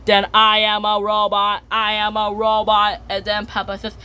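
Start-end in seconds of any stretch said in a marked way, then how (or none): 0.3-3.0 monotone robot, every syllable level; even, mechanical robot chant. Acted. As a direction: hold one pitch like a machine. Acts out a robot.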